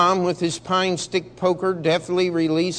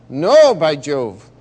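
slow emphatic "No, by Jove!"; loud exclamation; 8 dB louder, slower than usual. Acted. Officer dismayed at the potato dinner, loud exclamation. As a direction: protest it, drawn out and loud. Officer reacts to poor fare.

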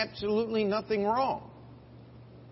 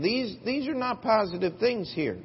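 ordinary delivery

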